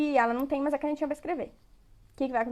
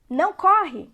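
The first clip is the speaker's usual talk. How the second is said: louder, higher mock order; acted parental command; 5 semitones higher, 7 dB louder, slower than usual. Example of what not to tell a child, acted loud command. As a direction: call it out like a parent checking a child. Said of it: "ao invés de você falar assim".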